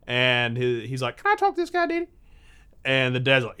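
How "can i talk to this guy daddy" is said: put-on high-pitched kid asking permission; very high child plea; young son's voice. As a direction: ask Daddy in a little boy's voice. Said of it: "to his dad to get permission".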